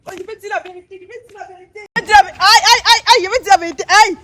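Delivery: high, loud cries of pain while confessing; pained pleading. Real, not acted. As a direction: wail and plead as the pain hits. Seized by pain, begs to confess. (supplication criée de douleur)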